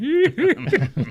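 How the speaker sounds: high, loud imitated squeal; excited squeal. Fan walking on stage behind his idol, squealing high. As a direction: re-enact a giddy squeal. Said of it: "like this".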